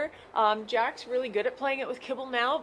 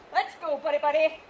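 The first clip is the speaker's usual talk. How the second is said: upbeat call to a dog; coaxing the dog; 3 semitones higher than usual. Real, not acted. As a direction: rev the dog up to play. Calling dog to start game.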